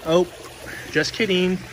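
ordinary talk

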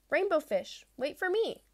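high little-fish call; calling after. Acted. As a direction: call out, hurrying to catch up. Little fish chasing after him.

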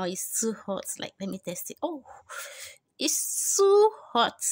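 ordinary delivery

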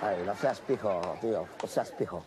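a Cuban playing at a Spaniard from Spain; mock Spain Spanish accent. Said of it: "estoy practicando el acento español".

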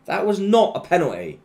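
high, loud, outraged; angry outburst. Real, not acted. Gamer yelling at the referee's decision, angry. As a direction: yell it at the ref. Disputing a penalty call.

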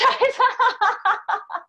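bursting into a loud, high laugh. Real, not acted. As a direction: laugh out at her own bad example. Laughs at her bad example.

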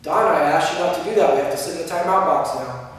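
even, unemotional instruction; matter-of-fact tone. Shown as a demonstration. Teacher sending a child to timeout, matter-of-fact. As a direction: take the emotion out and state it plainly. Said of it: "like just a matter-of-fact tone".

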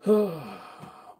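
long high sigh. Real, not acted. Reading bad news aloud.

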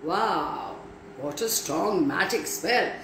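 ordinary delivery

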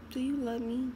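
put-on high, soft pleading girlfriend; puppy eyes. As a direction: imitate her wide-eyed, babyish plea. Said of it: "she was acting like this".

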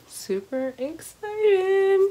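high, slow, giddy; excited squeal. Shown as a demonstration. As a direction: stretch it out, thrilled. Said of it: "This is how excited I am".

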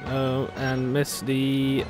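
ordinary delivery

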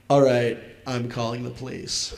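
low, quiet retold threat; understated, quiet resolve; deadpan threat. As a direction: state it softly and low, as the dad.

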